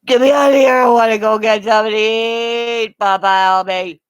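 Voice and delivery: dog hollering to be let out; shouting dog voice. Retold or imitated. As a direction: yell it as the impatient dog begging to go. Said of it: "he's a dog going".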